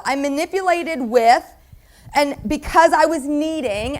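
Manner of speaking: normal talking voice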